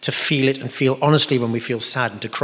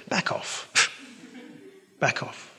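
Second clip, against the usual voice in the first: hushed, voiceless aside, much softer; whispered rebuff; 10 dB quieter, slower than usual. Acted. Preacher answering moaning Poms, whispered mock rebuff. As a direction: whisper it as a warning.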